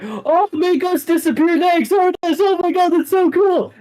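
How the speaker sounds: very high, loud squeals of awe; excited fans. Acted. Fans watching a teleport, begging to vanish, excited squeal. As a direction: squeal it like a thrilled crowd. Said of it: "They're just like".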